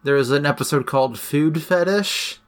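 normal talking voice

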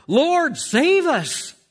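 high, loud plea for rescue; crying out. Retold or imitated. Disciples in the storm crying to Jesus, loud desperate plea. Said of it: "And they're crying out".

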